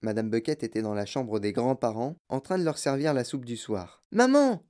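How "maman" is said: high, loud call for mom; child's high-pitched shout; excited yell. A narrator's reading. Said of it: "hurla Charlie".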